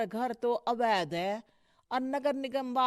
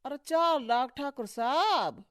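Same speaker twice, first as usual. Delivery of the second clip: higher-pitched startled question; shocked disbelief; 5 semitones higher, 8 dB louder than usual. Acted. Petitioner hearing bribe amount, shocked. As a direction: balk aloud at the demand.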